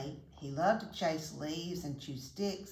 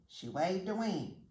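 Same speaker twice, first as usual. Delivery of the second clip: usual speaking voice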